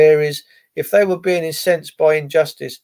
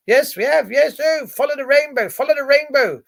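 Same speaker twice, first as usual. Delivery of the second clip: higher, lilting mock camp voice; sing-song it like a mocking cheer; lightly hammed camp chant; 8 semitones higher than usual. Mocking rainbow police.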